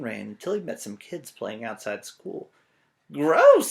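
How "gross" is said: high, loud "Gross"; grossed-out girl. Acted. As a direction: squeal it like a disgusted little girl. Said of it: "said a little girl".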